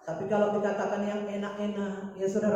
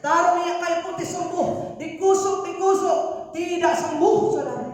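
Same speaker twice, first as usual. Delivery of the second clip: high, shouted sermon delivery; loud, soaring rhetorical challenge in short bursts; full-force preaching; 12 semitones higher, 12 dB louder than usual. Real, not acted. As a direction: hammer out each phrase to the congregation.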